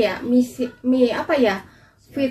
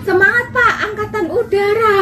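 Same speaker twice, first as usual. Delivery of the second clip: laughing; 7 semitones higher, faster than usual. Real, not acted. Cheering air force, laughing.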